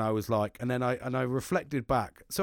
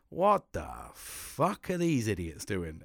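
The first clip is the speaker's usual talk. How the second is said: slow, lowered, drawn-out incredulity; imitated disbelief; 5 semitones lower, slower than usual. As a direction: act out an appalled onlooker, dragging the words. Imagining manager staring at camera.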